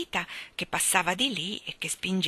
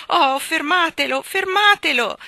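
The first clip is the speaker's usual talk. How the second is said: loud, high, urgent plea, rising on the repeat; anxious shout; 10 semitones higher, 10 dB louder than usual. A narrator's reading. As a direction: cry out for help, more desperate the second time. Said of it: "gridava Jane ansiosa".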